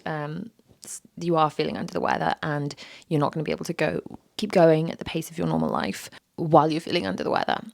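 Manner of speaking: normal talking voice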